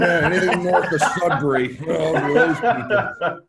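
loud, high, laughing mock lament; exaggerated outcry through laughter; mock disgust. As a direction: joke about them as if diseased, loudly. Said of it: "You sound like it's, like, a disease".

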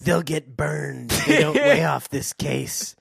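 a hint of sinister phone voice; menacing caller. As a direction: threaten him slowly. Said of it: "the man said".

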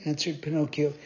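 plain reading voice

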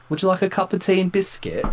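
high-pitched put-on British accent; mock British accent. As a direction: play him back as an overly British speaker. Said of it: "You sound like this".

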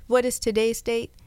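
ordinary talk, nothing marked

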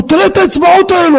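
high-pitched imitation of an excited man; excited stranger. Acts out the grateful stranger. (חיקוי של זר נרגש)

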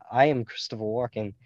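ordinary talk

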